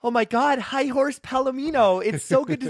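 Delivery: high, slow starstruck squeal; excited fan voice. Acted. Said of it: "two voices at once".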